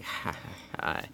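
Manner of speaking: quiet, half-hearted mock cackle; dry, subdued fake laugh; imitated cackle. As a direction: lightly imitate the Joker's laugh, softly. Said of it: "he's cackling, he's laughing".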